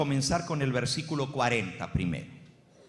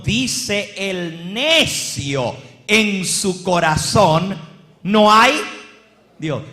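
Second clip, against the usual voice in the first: booming proclamation; 4 semitones higher, 11 dB louder, much slower than usual. Acted. Emphatic reading of the psalm.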